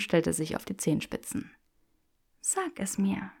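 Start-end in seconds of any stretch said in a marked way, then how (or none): 2.4-3.3 soft whisper against his chin; whispered demand. Acted. Granger pressing Draco, whispering close to his chin. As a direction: breathe the demand quietly. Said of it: "flüsterte sie".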